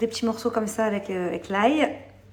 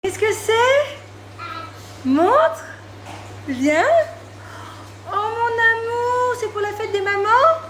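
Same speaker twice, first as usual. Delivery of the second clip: high sweet mom voice; 8 dB louder, much slower than usual. Real, not acted. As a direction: coo over the gift like a proud mom. Mother delighted at child's gift.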